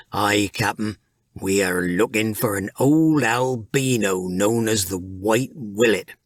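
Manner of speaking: a deckhand reporting to his captain; Peeve the sailor. A narrator's reading.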